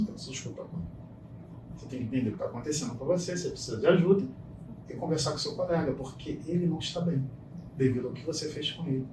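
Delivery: put-on quiet counsel to a boy who broke down crying; gentle counsel. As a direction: hush it down like a calm mentor.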